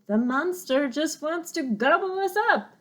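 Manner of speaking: high, loud panicked groundhog; shriek. Acted. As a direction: shriek before diving down the hole. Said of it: "shrieked Digtown".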